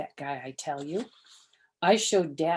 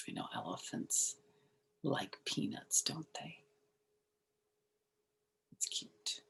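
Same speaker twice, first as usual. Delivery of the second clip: quiet aside; 2 semitones lower, 10 dB quieter than usual. Real, not acted.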